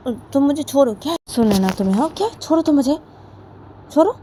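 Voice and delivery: loud, high-pitched indignant retort; high angry protest. Acted. Simran snapping at Sona to let her go, high and loud. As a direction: shout back at her, voice way up.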